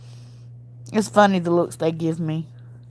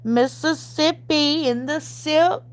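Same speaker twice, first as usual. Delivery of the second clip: sing-song saying; 8 semitones higher, slower than usual. Acted. Playful regional saying, sing-song.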